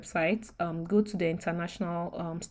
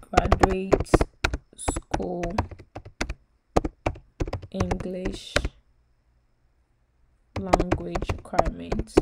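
words spoken one at a time with long gaps; dictating while typing; 5 dB louder, slower than usual. Shown as a demonstration. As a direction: say each word slowly as you type it. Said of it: "I'll just type".

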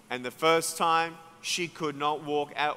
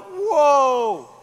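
higher, louder drawn-out whoa of amazement; amazed whoa; 9 semitones higher, 10 dB louder than usual. Retold or imitated. Crowd watching the healed woman walk, loud amazed whoa. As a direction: let the whoa ring out in wonder. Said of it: "We're all like".

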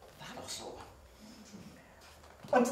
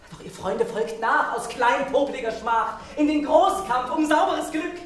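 rising, higher rallying cry; rousing call; 8 semitones higher than usual. Acted. Poet rallies his friends to follow into the fight, rousing. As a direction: build higher and higher to a battle call.

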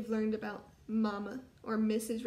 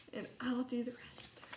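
soft unvoiced whisper; 8 dB quieter than usual. Real, not acted. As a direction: whisper it to the class. Teacher aside before reading.

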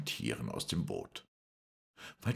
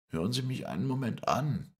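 firm, higher-pitched request; 10 semitones higher than usual. A narrator's reading. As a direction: ask firmly for a hearing. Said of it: "mit fester Stimme". (mit fester, gehobener Stimme)

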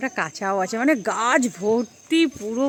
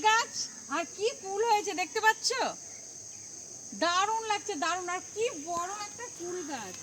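put-on high, soft voice for a little child; reading to a toddler; 9 semitones higher, 5 dB quieter than usual. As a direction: coo softly to a toddler. High soft voice showing garden.

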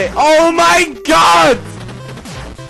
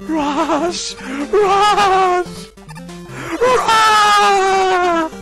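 high desperate calling; anguished cry; 13 semitones higher, much slower than usual. Real, not acted. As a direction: cry out for the dog. Dog dying in game.

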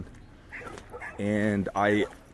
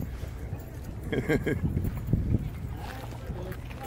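long, softer high laugh; amused laugh; 7 semitones higher, 5 dB quieter than usual. Real, not acted. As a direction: let a quiet giggle run on. Amused by dog in water.

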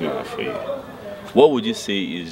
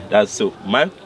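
ordinary delivery

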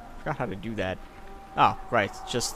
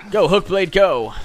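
loud cheer for the character; 7 semitones higher, 10 dB louder, slower than usual. Real, not acted. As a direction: shout him on.

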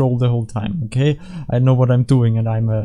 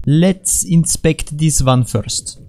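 very slow, measured syllables; drawn-out inspection; 3 semitones higher, much slower than usual. Pretend. Player about to inspect an object, drawn-out words. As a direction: draw each word out deliberately.

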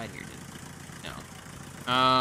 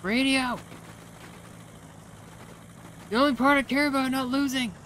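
high outcry of dismay; dismayed cry; 9 semitones higher than usual. Real, not acted. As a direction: cry out at the loss. Dismayed at losing radio.

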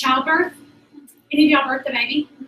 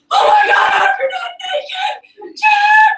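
high shrieking yell for Jim; panicked shriek calling Jim; retold scream; 5 dB louder, much slower than usual. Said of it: "I'm like / I'm screaming for him".

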